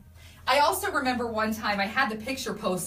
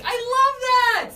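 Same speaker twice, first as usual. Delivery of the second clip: delighted; 9 dB louder, slower than usual. Real, not acted. Reacting to cute comment.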